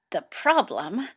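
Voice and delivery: words forced through clenched teeth. A narrator's reading. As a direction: grit your teeth and push it out. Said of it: "through clenched teeth".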